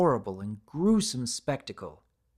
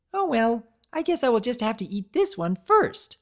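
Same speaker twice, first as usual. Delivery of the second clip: high, louder offhand creator; creator's voice; 9 semitones higher, 6 dB louder than usual. A narrator's reading. Creator decides to eat the broken cookie, high and offhand. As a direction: shrug it off in a high voice.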